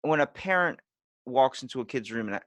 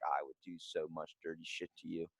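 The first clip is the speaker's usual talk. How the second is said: low, very soft retold murmur; private lusting aside; 5 semitones lower, 13 dB quieter than usual. Teenager talks to poster in brother's room, low murmur.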